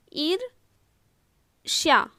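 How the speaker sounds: ईर्ष्या split slowly in two, "eer... shya"; slow, spaced-out ईर्ष्या for dictation; broken into parts. Shown as a demonstration. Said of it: "अब देखिए यहाँ पे".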